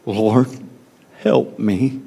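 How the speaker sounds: soft plea with the stress on "help", rising at the end; emphatic quiet plea. Shown as a demonstration. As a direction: lean on "help" softly and lift the end like a desperate question. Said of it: "in the emphasis".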